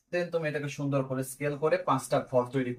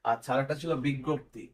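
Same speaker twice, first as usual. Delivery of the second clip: ordinary delivery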